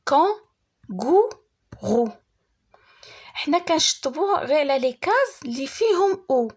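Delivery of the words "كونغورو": "كونغورو" slowly as kon, gou, rou with pauses; "كونغورو" one syllable at a time; split into syllables. Shown as a demonstration. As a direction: break "كونغورو" into three separate syllables. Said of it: "فيها تخوا سيلاب".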